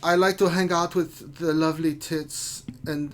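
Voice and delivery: put-on suggestive German accent; cheeky put-on German guy; Friedrich. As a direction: play it naughty, in a German accent. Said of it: "when you say it in a German accent".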